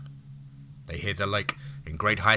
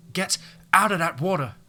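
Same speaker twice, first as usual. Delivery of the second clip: loud high furious shout; angry scream; 10 semitones higher, 5 dB louder than usual. Acted. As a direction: scream the order at him. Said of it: "Daria screamed at him".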